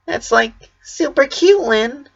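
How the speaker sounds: a spacey big sister gushing through a grin; smiling, airy. A narrator's reading. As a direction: coo it with a big smile. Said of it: "smiling the way only she could".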